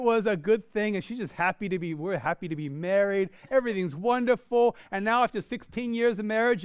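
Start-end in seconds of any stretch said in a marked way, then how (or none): none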